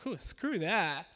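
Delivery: slow, drawn-out scoff; scoffing dismissal. Retold or imitated. Churchgoer dismissing the verse, slow scoffing brush-off. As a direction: brush it off slowly. Mocking reaction to forgiving enemies.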